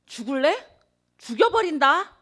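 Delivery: mock angry threat, pitch climbing to a high loud finish; kids' squabble, a mock furious threat; retold childish threat. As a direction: replay a schoolyard quarrel threat with a rising loud edge. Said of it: "하는 그 말".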